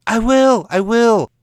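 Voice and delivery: high, loud, eager protest; eager pleading. Acted. Author cuts in on his agent, high and loud.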